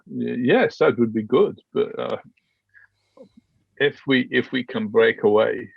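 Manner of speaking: normal talking voice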